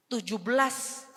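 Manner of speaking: ordinary talk, nothing marked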